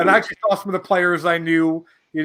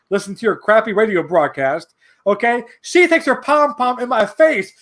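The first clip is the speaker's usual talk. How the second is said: usual speaking voice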